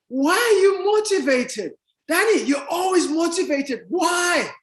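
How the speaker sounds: high-pitched mock puzzlement; insistent questioning. People asking Danny about motivation, exaggerated puzzlement.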